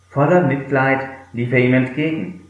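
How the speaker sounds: normal talking voice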